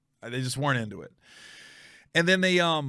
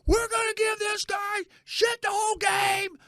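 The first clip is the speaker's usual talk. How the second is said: much higher, slow mock shout; rowdy fan shout; slower than usual. Young fan announcing he'll heckle the comic, high slow shout. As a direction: hype up the section against him. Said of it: "he was like shout".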